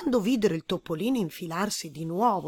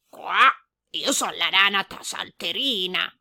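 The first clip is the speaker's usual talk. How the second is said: louder croaky frog introducing herself; 5 dB louder than usual. A narrator's reading. Frog introducing herself to the mouse, croaky. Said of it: "rispose la rana".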